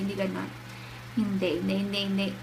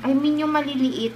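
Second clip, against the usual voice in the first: ordinary talk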